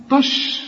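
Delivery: طش with a thick emphatic ط for ت; wrong heavy ta. Shown as a demonstration. Teacher mimics student's mistake, heavy first consonant. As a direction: deliberately mispronounce تش as heavy طش. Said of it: "طبعًا لا".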